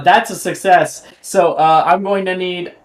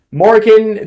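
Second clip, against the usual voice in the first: ordinary delivery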